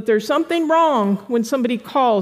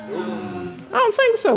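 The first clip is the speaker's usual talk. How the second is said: mock disbelief; 10 semitones higher, slower than usual. Mocking the pacifier-seeking teen.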